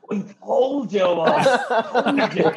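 high, loud triumphant imitation through laughter; laughing mock boast. Teammate crowing to Kenny Brown, imitated through laughter. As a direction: crow it while cracking up.

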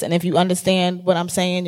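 normal talking voice

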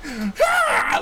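very high mock scream; imitated kid yell. Little kid thrown off, high mock yell. Kid yells after falling off.

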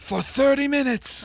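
mock wail. Joking about falling forever.

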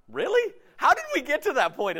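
soaring high disbelieving question; incredulous. Real, not acted. Preacher mocking the boss's logic, incredulous high question. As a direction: jump up in pitch in disbelief. Incredulous reaction to firing scenario.

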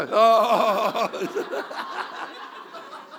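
big loud rising laugh; loud burst of laughter. Real, not acted. Pastor laughing at his own joke before the congregation, loud laugh.

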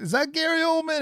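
high-pitched, incredulous question; retold amazement. Movie fan recalls spotting an actor in disguise, retold amazement. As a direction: act out recognizing someone, voice climbing high. Retelling his reaction to an actor.

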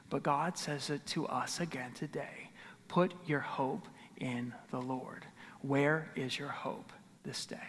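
soft whisper to the congregation; hushed whisper, voice fully breathed; whispered appeal. Acted. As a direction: lean in and whisper the call.

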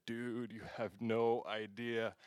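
slow, hushed, dazed; awed hush. Retold or imitated. Telling his friend about the kiss, slow awed hush. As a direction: let it out slow and soft.

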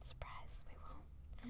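hushed whisper, breathy and voiceless. Real, not acted. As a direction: lean in and whisper the punchline. Echoing the joke in a whisper.

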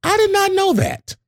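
very high, astonished reply; hammed-up wide-eyed discovery; mother's retold surprise. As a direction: imitate an amazed mother, voice way up. Mother's surprise at the new term.

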